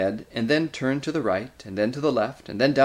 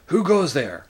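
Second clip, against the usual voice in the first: louder, higher sentry challenge; mild snarl; 6 semitones higher, 5 dB louder than usual. A narrator's reading. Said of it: "asked them in snarling voices".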